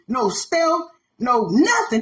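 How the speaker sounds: ordinary talk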